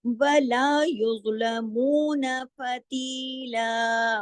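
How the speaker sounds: slow, melodic tajweed recitation; Quran recitation. Shown as a demonstration. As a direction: recite वला युज़लमून फतीला slowly with care. (तजवीद के साथ तिलावत)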